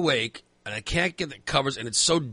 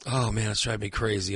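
usual speaking voice

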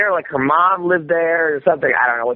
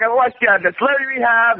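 starstruck gush; 8 semitones higher, faster than usual. Retold or imitated. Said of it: "I was, like, starstruck".

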